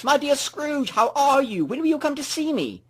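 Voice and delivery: high, bright friendly passerby voice; a hearty neighbour calling out in greeting; cheery greeting. A narrator's reading. A passerby greeting Scrooge on the street, cheerful and high.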